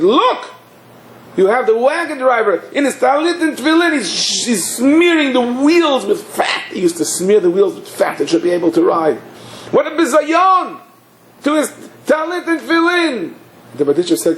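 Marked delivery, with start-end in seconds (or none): 0.0-6.6 high-pitched imitation of outraged townsfolk; scandalized complaint. Townspeople denounce the wagon driver. 9.7-13.3 high-pitched imitation of outraged townsfolk; scandalized complaint. Townspeople cry out at the disgrace.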